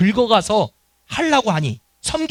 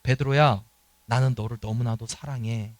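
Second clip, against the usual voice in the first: soft tender assurance; 8 semitones lower, 6 dB quieter than usual. Pretend. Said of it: "라고 말씀하시는 거예요".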